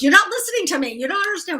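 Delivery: high, mildly exasperated protest; imitating angry friend. Friend mad at her over the phone, mock exasperation. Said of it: "she was getting madder and madder at me".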